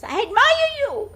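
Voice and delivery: mountain echo, very high and loud; echoed shout. A narrator's reading. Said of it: "voice answers".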